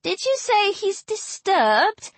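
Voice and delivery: high, drawn-out innocent question; mock-innocent needling; feigned mishearing. A narrator's reading. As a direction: tease with a slow, rising query. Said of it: "pretended not to have heard".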